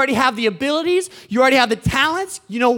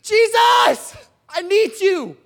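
high-pitched cry for help; desperate yell; 9 semitones higher, 3 dB louder, slower than usual. Acted. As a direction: call out to Jesus in acted desperation. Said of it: "they're gonna have to yell my name".

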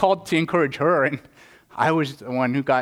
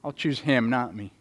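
ordinary talk, nothing marked

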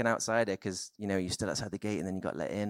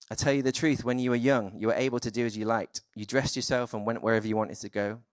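louder, formal reading of the passage; scripture reading; 4 dB louder than usual. Acted. Preacher reading the Gospel passage aloud to the church, formal reading. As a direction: proclaim the Bible text to the congregation.

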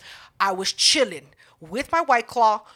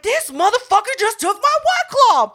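much higher, louder, shocked outcry; outraged disbelief; 12 semitones higher, 7 dB louder than usual. Retold or imitated. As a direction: cry out in shocked outrage, pitched way up. Beer stolen by security.

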